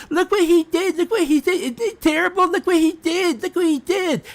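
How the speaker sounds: high, wailing mock of a crying five-year-old; whiny child mockery. Streamer mocking haters as crying children, high whine. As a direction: whine it like a tattling kid. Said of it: "crying like a five-year-old".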